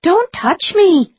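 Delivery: high, slow startled cry; yelped. A narrator's reading. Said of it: "she yelped".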